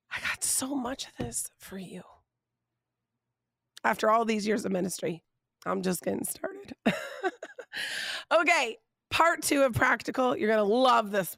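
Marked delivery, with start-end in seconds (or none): 0.1-2.0 whispered quote. Retold or imitated. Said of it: "he's saying". 6.8-8.3 high airy laugh; breathy laugh. Real, not acted. Laughs at her own remark.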